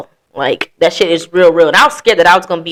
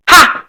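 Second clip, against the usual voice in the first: one loud, high mock laugh; sharp burst of triumphant mock laughter; gloating "ha"; 9 semitones higher, 5 dB louder than usual. Wronged ex hearing a late apology, gloating "ha".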